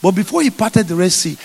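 usual speaking voice

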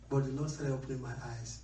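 ordinary talk, nothing marked

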